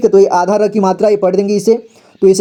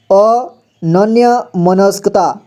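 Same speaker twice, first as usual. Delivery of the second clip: very slow spelling-out; much slower than usual. Shown as a demonstration. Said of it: "और यहाँ देखिए अनन्य मनस्कता".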